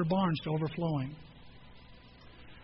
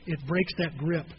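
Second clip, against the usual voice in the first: ordinary talk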